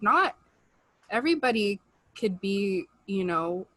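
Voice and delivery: ordinary talk, nothing marked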